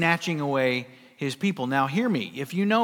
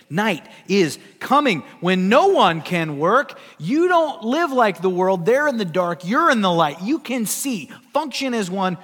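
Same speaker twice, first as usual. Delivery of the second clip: louder, slow and emphatic, driving each line home; forceful exhortation; 3 semitones higher, 6 dB louder than usual. Real, not acted. Preacher urging believers to live in the light, loud and slow.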